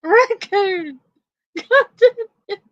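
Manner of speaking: high squeaky laughing words; talking through helpless giggles. Real, not acted. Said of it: "I snorted".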